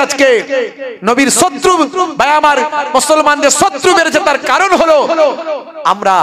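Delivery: fiery sermon; preaching at full force. Acted. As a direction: rouse the congregation.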